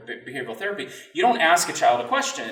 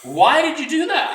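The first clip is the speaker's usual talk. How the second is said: sharp, much higher and louder at himself; self-scolding; 7 dB louder than usual. Retold or imitated. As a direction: snap at yourself for the slip. Scolding himself inside his head.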